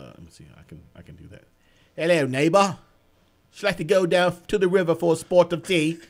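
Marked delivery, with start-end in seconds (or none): 1.9-6.0 hammed-up Australian-English accent; badly faked Australian and English accent; Australian sliding into English accent. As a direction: greet them in a wobbly Australian accent turning English. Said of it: "Australia to English".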